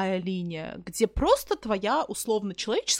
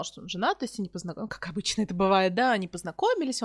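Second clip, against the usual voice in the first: normal talking voice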